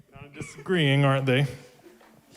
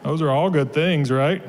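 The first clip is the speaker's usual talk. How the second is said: ordinary delivery, nothing marked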